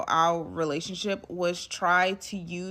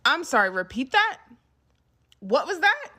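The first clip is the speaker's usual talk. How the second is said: higher-pitched, disbelieving questions; incredulous; 6 semitones higher, 4 dB louder than usual. Retold or imitated. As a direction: ask as if you can't believe your ears. Retelling her stunned reply to him.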